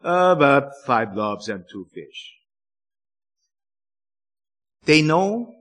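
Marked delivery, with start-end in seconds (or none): 0.0-2.4 a lower, deflated "Uh, but five loaves and two fish"; unexcited. Shown as a demonstration. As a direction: say it glumly, with no excitement. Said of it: "not in an excited, excited way".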